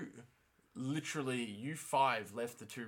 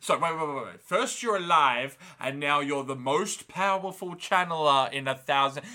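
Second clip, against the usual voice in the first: louder, incredulous rant; freaking out; 4 semitones higher, 9 dB louder than usual. Retold or imitated. Egwene venting her outrage at the others, loudly. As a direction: demand answers, voice raised. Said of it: "she's freaking out".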